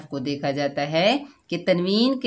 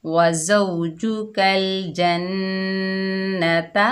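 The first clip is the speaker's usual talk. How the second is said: slow, drawn-out Quranic recitation; much slower than usual. Shown as a demonstration. Teacher reciting the word for pupils, slow chanted recitation. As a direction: recite it slowly, like tilawat. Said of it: "बगैर खींचे पढ़ेंगे".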